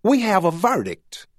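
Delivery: high, proud character voice; proud announcement. A narrator's reading. Clerk telling the judge the news, proudly announced. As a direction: announce it proudly, pitch lifted well up. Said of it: "proudly announced".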